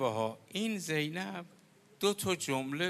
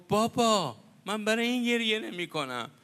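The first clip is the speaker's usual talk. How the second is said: protesting daughter; 7 semitones higher, 5 dB louder than usual. Retold or imitated. Daughter protests to her dying father.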